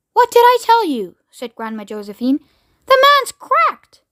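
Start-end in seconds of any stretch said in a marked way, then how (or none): 0.1-1.2 high-pitched indignant exclamation; indignant grandmother. A narrator's reading. Grandma Josephine complaining about Mister Wonka, indignant. 2.8-4.0 very high, slow, loud exclamation; indignant outburst. A narrator's reading. Grandma Josephine declaring Mister Wonka mad, indignant outburst.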